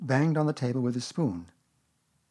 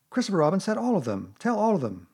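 higher, louder insisting voice; insistent Owl; 8 semitones higher, 5 dB louder than usual. Acted. Owl insists in story quarrel.

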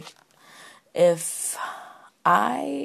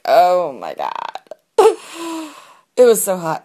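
swooning reaction; 9 dB louder than usual. Retold or imitated. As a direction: stretch it out loudly, retelling being smitten. Retelling her swooning reaction to accent.

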